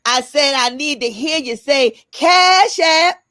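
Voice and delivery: preacher shouting for a response; loud church call. Pretend. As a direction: belt it out to the congregation. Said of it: "So I'm gonna do my church voice".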